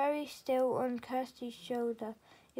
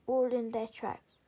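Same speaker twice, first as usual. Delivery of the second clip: ordinary delivery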